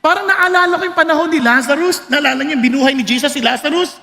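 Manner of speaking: ordinary delivery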